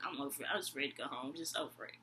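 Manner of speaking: low, soft, resigned inner voice; fed up. Retold or imitated. Woman just ready to go home from her date, quietly fed up. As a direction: drop low and quiet, done with it. Retold wish to end the date.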